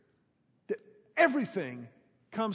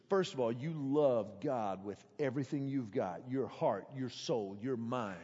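imitating stern pastor; 8 semitones lower, 5 dB quieter than usual. Pastor lecturing a fifteen-year-old, lower grave counsel. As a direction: lay down the law like a commanding Southern pastor. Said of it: "He goes".

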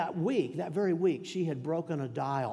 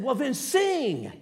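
son's retort; 9 semitones higher, 7 dB louder, much slower than usual. Retold or imitated. Son's quoted demand.

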